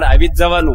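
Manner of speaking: આવી punched loud and falling; emphatic urging. Acted. Preacher urges the congregation to come, emphatically. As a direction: hit આવી hard to insist.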